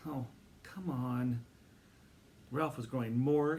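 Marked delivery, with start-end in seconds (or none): 0.1-1.4 slow, drawn-out coaxing; impatient pleading. A narrator's reading. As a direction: drag it out pleading.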